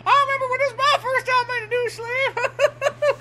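high put-on voice; hammed-up encouraging old hand; playing along as coach. Joins in acting the coach.

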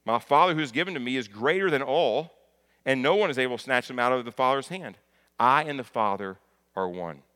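loud, emphatic proclamation of the verse; preacher driving a verse home; forceful scripture reading. Acted. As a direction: proclaim it with full conviction.

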